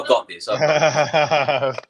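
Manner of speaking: slow, laughing mock swagger; laughing mock bravado; cocky confidence. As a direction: drawl it like you can handle anything. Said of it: "I thought".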